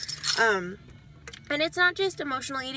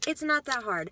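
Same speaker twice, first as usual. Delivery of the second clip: normal talking voice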